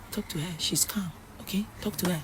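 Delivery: imitated whispering, voice kept small and soft; mock whisper. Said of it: "Kwame now whispering to girl".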